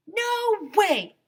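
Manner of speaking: high, loud defiant yell; defiant shout. Acted. Ice cream man yelling back at the principal, defiant. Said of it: "Mister Will shouted".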